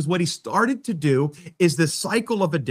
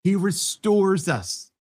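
RESTORES drawn out slow and weighty; slow emphatic; 4 semitones higher, much slower than usual. Shown as a demonstration. Preacher answering his own question, slow and emphatic. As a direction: let each word land slowly, "He restores us". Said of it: "what does he do?".

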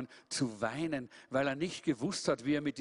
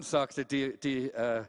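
plain reading voice

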